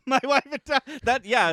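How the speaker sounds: high, loud laughing quote. Retold or imitated. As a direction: laugh through the words.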